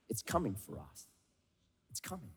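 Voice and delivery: very soft, sinking almost to nothing; ominous hush. Real, not acted. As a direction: let the warning fade into a hush. Ominous warning that death approaches.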